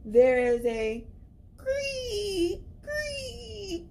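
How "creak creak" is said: high creaking wail; creaky door voice. Acted. Spooky door sound.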